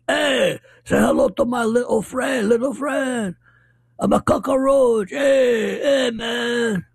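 hammed-up, terrible Cuban accent, pitched high; bad Cuban accent. Host mocking Al Pacino's Scarface, bad Cuban accent. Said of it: "this is the worst Cuban accent I've ever heard".

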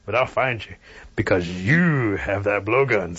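a bear backing down but vowing revenge; menacing bear. Acted. Bear threatens the armed Cherokees.

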